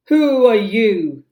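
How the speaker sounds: loud, slow challenge; demanding. Acted. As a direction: demand it into the dark. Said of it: "demanded Hook".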